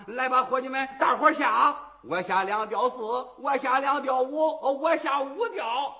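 loud overlapping shouts of bets; loud betting calls. Acted. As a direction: call out bets over the crowd. Gamblers shout their bets.

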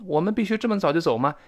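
normal talking voice

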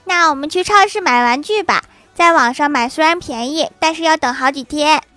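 put-on high-pitched child's wheedling; child pleading. Child coaxes dad to buy toys, pleading. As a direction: talk dad into it in a kid's voice. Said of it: "[name]说".

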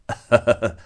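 put-on loud staggering drunk's chuckle; drunken laugh. Drunk Ni Er laughs on recognizing his neighbor, loud and tipsy. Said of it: "趔趄着笑道".

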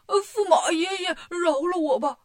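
high, crying beg for mercy; a weepy, pleading cry; whimpering plea. Acted. Bajie begging the prince consort, crying.

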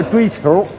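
追求 with an r-coloured ending, like "chasing a ball"; mispronounced 追求. Pretend. Telling of a young woman courting, 追求 mispronounced as zhuī qiúr. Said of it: "那叫追求啊".

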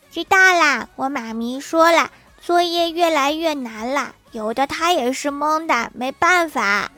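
put-on high, childish and sing-song; cute little girl voice. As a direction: play a chirpy schoolgirl. Schoolgirl answers teacher.

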